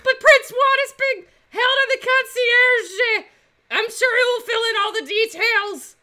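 high, loud squeaky mouse voice. Acted. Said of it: "I have to do the mouse voice".

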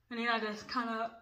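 talking with fingers in mouth; fingers on teeth. Real, not acted. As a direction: lips held apart by fingers. Seen 3: Fingers press a strip onto her teeth while she speaks.

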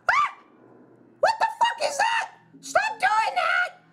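high panicked scream at the screen; terrified shriek. Real, not acted. Player screaming at the jumpscaring monster, terrified. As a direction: shriek it in real fright.

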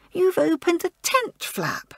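high, slow accusation; accusing. Acted. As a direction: point the finger at her. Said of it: "Arabella accused Joan".

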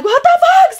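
squealed high and loud in disbelief; shocked outburst. Retold or imitated. Stuck on the stool, shocked high squeal. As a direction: shriek it like you can't believe it. Heel stuck in skirt, drunk.

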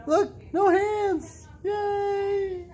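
very high, slow delighted shout; excited cheer. Real, not acted. Mother sees baby stand hands-free, high excited shout. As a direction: cheer her on, thrilled.

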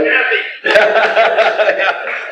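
high laugh falling away. Real, not acted. Telling the congregation, high falling laugh. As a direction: laugh at the memory.

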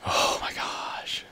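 drawn-out, slow whisper; slow stunned whisper. Real, not acted. Player whispering to himself after nearly dying, slow stunned whisper. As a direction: let it out in a slow hush. After near death, alone.

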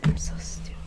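normal talking voice

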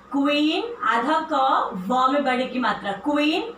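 normal talking voice

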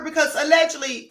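usual speaking voice

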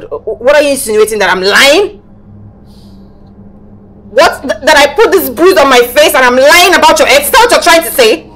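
loud, offended challenge; indignant. Acted. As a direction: throw the accusation back, loud and hurt. Woman accused of lying hits back.

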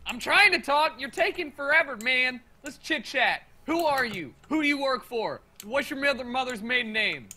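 loud, pushy questioning; loud interrogation. Acted. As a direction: grill him like a cop.

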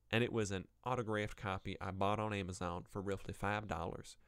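put-on Southern US accent for a scathing review. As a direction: read the complaint with a Southern twang. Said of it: "reading in a southern accent once again".

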